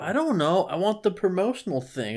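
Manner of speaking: a mildly whiny customer asking for the promo. Retold or imitated. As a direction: play the lost customer pleading. Mocking a customer ordering.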